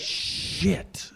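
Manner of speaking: long hushed hiss of a curse; drawn-out, voiceless mock dismay; stunned realisation. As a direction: whisper it slowly, letting it sink in. Acting someone realizing they are stuck.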